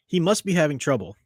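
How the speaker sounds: normal talking voice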